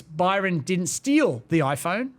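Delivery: "steal" stretched and lifted, slow delivery; stress on steal. Shown as a demonstration. Demonstrating tone shifts meaning, with stress on "steal". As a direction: punch "steal", hinting he did something else with it. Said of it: "What if I say it like this?".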